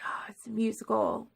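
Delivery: hushed whisper of sudden understanding; awed whisper, realizing it at last; whispered realization. Retold or imitated. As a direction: breathe it out as a discovery. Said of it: "and I'm like".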